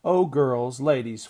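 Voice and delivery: fluttering. A narrator's reading. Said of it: "fluttered Missus Ogilvy".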